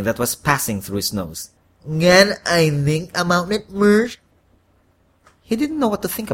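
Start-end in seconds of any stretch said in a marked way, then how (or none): 1.8-4.2 slow, higher congested voice; stuffy-nosed plea. A narrator's reading. Congested man asks the devil for time, stuffy-nosed. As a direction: stall through a blocked nose. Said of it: "with a stuffy nose".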